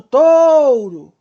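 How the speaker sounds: "touro" forte e agudo, com o O sustentado; O que dura mais. Shown as a demonstration. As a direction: estique o O de "touro", caindo no U. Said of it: "vai ser a que vai durar mais". ("touro" enfático, vogal longa e decrescente)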